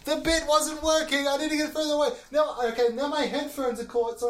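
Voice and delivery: high, soft, moving off mic; far from mic. Real, not acted. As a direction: call out from across the room. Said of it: "further away from the mic".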